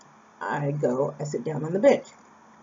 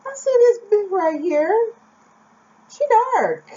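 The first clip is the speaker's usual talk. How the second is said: very high, loud mock exclaim; shocked old man; 5 dB louder than usual. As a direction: blurt it out in comic shock. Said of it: "shocked by the whole thing".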